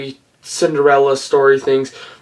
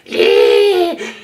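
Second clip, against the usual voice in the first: high giggle. Retold or imitated. Giggle after the voice.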